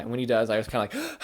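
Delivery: ordinary delivery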